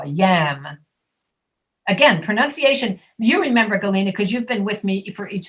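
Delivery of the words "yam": lower-pitched YAM, jaw dropped wide; open-jawed yam. Shown as a demonstration. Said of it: "you have to really drop your jaw".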